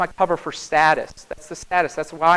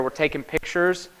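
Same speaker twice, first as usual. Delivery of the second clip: usual speaking voice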